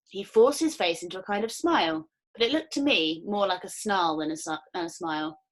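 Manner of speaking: usual speaking voice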